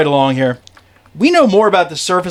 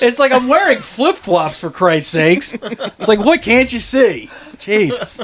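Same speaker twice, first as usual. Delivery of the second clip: kvetching comedian; 6 semitones higher, slower than usual. Pretend.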